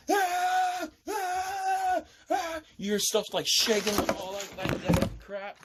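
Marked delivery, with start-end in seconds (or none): 0.1-2.6 high, sustained mock screams; repeated high "ah" screams; imitated screaming. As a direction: cry out like panicked people, acted. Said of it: "people screaming like".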